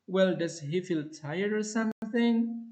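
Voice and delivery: one rising tone through or something; rising to the end. Shown as a demonstration. Teacher nudging the pupil, rising. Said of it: "continues the rising".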